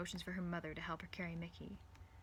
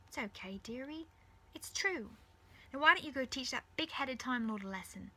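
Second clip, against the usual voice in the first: high-pitched motherly encouragement; high mother voice, reassuring; consoling mother; 7 semitones higher, 5 dB louder than usual. Acted. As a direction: cheer on a daughter after comforting her.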